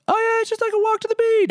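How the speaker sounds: very high-pitched dismissive mimicry; mock breezy. Traveler mimicking those who called the hike easy, mock breezy. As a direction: make it sound absurdly easy, way up high.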